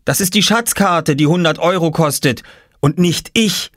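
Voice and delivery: put-on high, indignant child's voice; protesting boy. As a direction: insist like an offended little boy. Boy protests he is not for sale. (empörte, hohe Jungenstimme)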